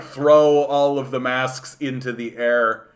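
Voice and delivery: ordinary talk, nothing marked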